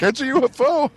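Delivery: hammed-up terror over a UFO; mock panic. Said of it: "fake panic modes".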